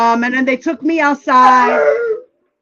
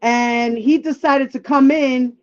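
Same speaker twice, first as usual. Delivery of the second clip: ordinary talk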